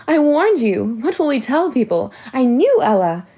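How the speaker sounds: high, loud, accusing; upset scolding. A narrator's reading. Hattie blaming her mother after the news, high and loud. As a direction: lash out at her mother in alarm.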